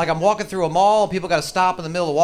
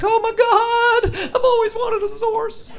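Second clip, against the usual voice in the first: very high, slow gushing squeal; spoiled girl squeal; 4 dB louder, much slower than usual. Retold or imitated. The little girl getting her Zorse, a very high slow squeal. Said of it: "She's like".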